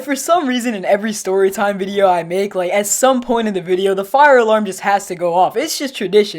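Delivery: ordinary talk, nothing marked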